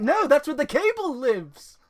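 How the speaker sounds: high loud mock protest; mock exasperated cry. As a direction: exclaim it in mock alarm. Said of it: "I'm like".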